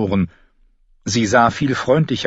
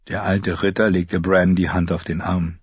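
plain reading voice

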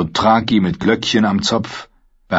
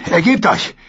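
shouting demand; 9 semitones higher than usual. Acted. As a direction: bark it down at the beaten man. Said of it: "und schrie". (laut geschrien)

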